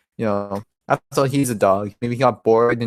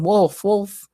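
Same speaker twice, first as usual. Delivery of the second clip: higher-pitched mock call, not shouted; calling the dog; 8 semitones higher than usual. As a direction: call out lightly for a lost dog. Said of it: "when Rip called his name".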